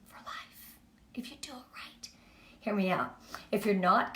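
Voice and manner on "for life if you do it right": whisper. Acted. Confiding the big payoff.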